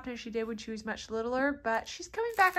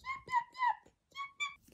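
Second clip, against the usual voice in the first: softer puppy yaps; 6 dB quieter than usual. Acted. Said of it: "Can you yap like a puppy?".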